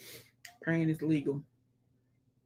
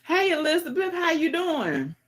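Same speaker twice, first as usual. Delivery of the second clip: cheery greeting; 11 semitones higher than usual. Real, not acted. Greeting viewer in sing-song.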